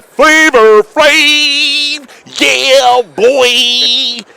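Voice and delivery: high, slow hype-man shout. Pretend. Hyping [name] catchphrase.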